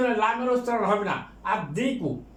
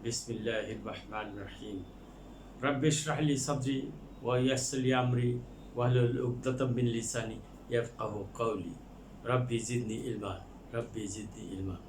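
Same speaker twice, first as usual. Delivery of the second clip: quiet prayer recitation; 10 semitones lower, 10 dB quieter than usual. Acted. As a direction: recite the Arabic supplication softly and devoutly. Opening Arabic prayer before lesson. (নিচু স্বরে ধীর দোয়া পাঠ)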